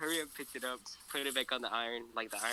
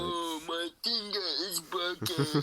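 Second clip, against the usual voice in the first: slow, high hammed-up wail; mock a crybaby, dragging every word out; exaggerated pained whine, pitched up; mock whining; 6 semitones higher, 3 dB louder, much slower than usual. Mocking brother's overreaction to hurt finger.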